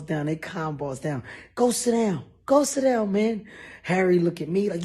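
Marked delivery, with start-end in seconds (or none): 1.5-3.5 higher, louder command; firm shouted order. Retold or imitated. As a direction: order him to sit. Friends ordering him down.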